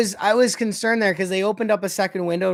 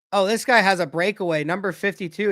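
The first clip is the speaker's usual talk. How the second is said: ordinary delivery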